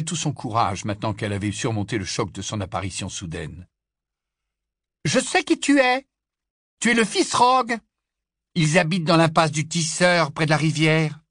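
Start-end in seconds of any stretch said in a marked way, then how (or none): 5.1-11.2 high, loud contemptuous voice; sneering girl. Acted. Big sister sneers at the boy's family, high and scornful. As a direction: look down on him.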